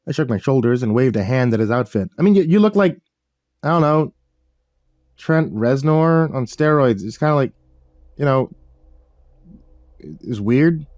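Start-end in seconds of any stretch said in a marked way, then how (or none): 2.2-10.9 higher awkward hero voice. A narrator's reading.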